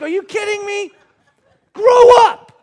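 high-pitched mock outrage, building to a yell; incredulous, then loudly shouting; exasperated dad. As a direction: act the fed-up father blowing up. Exasperated father to small son.

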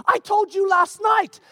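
slow, high, stretched-out reproach; mock exasperated scolding. Wife to forgetful husband, mock exasperated scolding. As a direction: imitate a wife fed up.

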